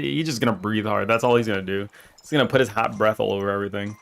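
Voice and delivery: ordinary delivery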